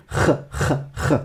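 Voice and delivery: "Kh" as short voiceless throat scrapes; isolated letter sound. Shown as a demonstration. Teacher has learners retain the sound, bare "kh" rasps. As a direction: rasp "kh" softly, without a vowel. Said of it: "le son qu'elle fait".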